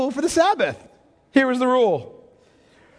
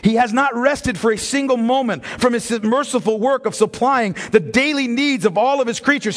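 ordinary talk, nothing marked

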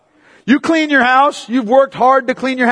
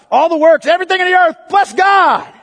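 builds higher and louder to a shouted bless God; rising exhortation; 7 semitones higher, 4 dB louder than usual. Acted. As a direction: climb to a fervent shout. Preacher builds to a cry.

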